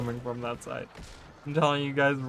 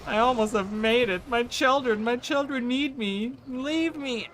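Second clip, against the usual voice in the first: melodramatic pleading; 11 semitones higher, 3 dB louder than usual. Pretend. Melodramatic plea while dying.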